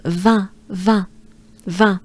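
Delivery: "vin" with the nasal vowel of French un; same nasal sound. Shown as a demonstration. Teacher links wine to number one, same nasal. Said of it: "It's the same sound".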